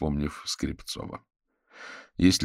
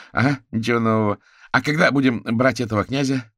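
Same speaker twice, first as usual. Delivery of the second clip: higher, louder, pushing for action; impatient echo; 8 semitones higher, 7 dB louder than usual. Acted. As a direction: echo it back and press on impatiently. Eager Kuzya echoes, presses on.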